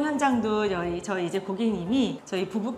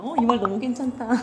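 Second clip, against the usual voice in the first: laughing as you admire your own words; pleased, laughing aside; amused self-praise; 3 semitones higher, 3 dB louder than usual. Real, not acted. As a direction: break into a real laugh. Laughs at own fine line.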